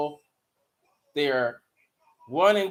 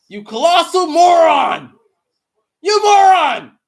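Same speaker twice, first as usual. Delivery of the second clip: high, loud mock yell at the player; hammed-up furious yell; furious shout; 10 semitones higher, 13 dB louder than usual. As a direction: scream it at him like a furious coach. Yelling at crying player.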